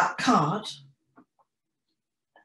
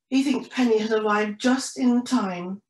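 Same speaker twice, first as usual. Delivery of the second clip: usual speaking voice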